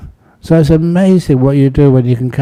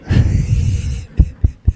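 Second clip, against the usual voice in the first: breathy, wheezing chuckle; real laugh; 4 dB quieter than usual. Real, not acted. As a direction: chuckle to yourself without voice. Laughs at own repeated appeal.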